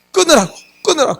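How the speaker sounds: a flustered producer yelling to cut it; frantic order. Retold or imitated. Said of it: "피디가 난리가 났대요". (다급하게 빨리 끊으라고 외치는 피디)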